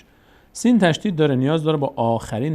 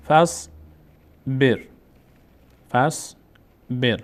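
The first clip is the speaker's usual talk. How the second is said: each syllable drawn out, pause between; slow syllable-by-syllable reading; much slower than usual. Shown as a demonstration. Teacher models exercise words for students, slow syllable-by-syllable reading. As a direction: sound it out slowly for learners to repeat.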